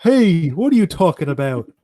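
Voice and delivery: offended frog denying it; Kermit voice. Pretend. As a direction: Kermit protesting the accusation. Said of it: "Pennywise luring Kermit".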